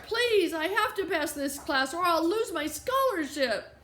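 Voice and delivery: high, urgent plea; pleading. A narrator's reading. Late student begs the office lady for mercy, pleading.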